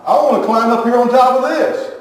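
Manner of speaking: high, loud mock children's protest; kids hollering back. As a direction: shout back like bossy little kids. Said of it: "she's hollering at them and they're saying".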